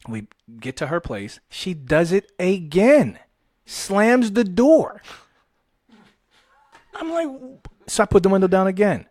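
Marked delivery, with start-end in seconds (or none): 1.5-5.0 slow, drawn-out, rising exasperation; incredulous slow punch on every word; dramatic emphasis. Real, not acted. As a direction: stretch out each word, building louder and higher. Dramatic climax of the story.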